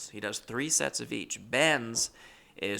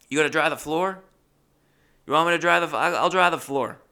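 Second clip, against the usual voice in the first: loud, raised-pitch retold shouting; shouting at the deaf; 6 semitones higher, 9 dB louder, faster than usual. Narrator and deaf roommate about the wet floor, shouted. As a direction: shout it so a deaf man hears. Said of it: "you gotta fucking scream at this dude".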